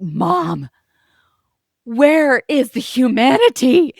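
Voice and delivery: high, loud, drawn-out theatrical despair; imitated dramatic outcry. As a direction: wail it like an appalled daughter, hammed up. Daughter's dramatic outcry retold.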